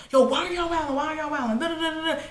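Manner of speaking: high-pitched imitation of someone yelling; put-on high yell, running into da-da-da; mock yelling. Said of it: "the brother is yelling again".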